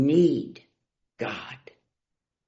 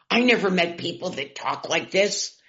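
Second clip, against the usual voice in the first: usual speaking voice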